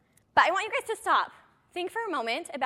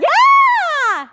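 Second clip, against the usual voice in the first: much higher, much louder whoop; cheering shout; 15 dB louder than usual. Acted. Coach whoops to get the audience cheering about paychecks. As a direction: whoop like a rally crowd.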